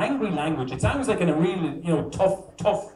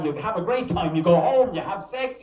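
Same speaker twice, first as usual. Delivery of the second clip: ordinary talk, nothing marked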